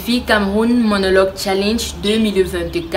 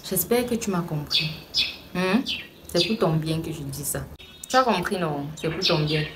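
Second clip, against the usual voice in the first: quieter, lower motherly reassurance; softened admonishing; 3 semitones lower, 8 dB quieter than usual. Acted. Mother tells her daughter it is for her good, softly admonishing. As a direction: drop the voice and soften after scolding.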